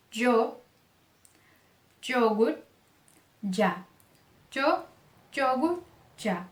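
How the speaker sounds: yo, yogurt, ya beginning with "dj". Shown as a demonstration. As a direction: hit a "dj" at the start of yo, yogurt and ya. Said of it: "pode ser pronunciado como D J". (Y com som de DJ em yo, yogurt, ya)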